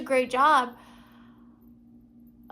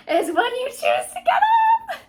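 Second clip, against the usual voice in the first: much higher rousing uplift; inspired; 10 semitones higher, 5 dB louder than usual. Real, not acted. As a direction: lift it like a revelation. Rising hopeful conclusion.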